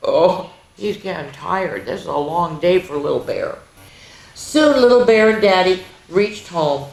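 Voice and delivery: ordinary delivery, nothing marked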